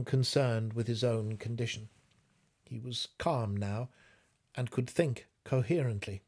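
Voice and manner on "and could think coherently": ordinary delivery, nothing marked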